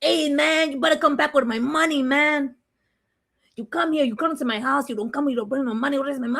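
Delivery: a hint of a tough Chicano accent, mildly voiced; light Chicano accent. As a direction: lightly play a Chicano tough guy demanding money. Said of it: "turn into a whole Chicano".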